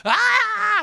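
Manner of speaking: very high shrill shriek of pain; imitated scream. Preacher acting the injured woman's scream. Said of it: "screaming and holding her leg".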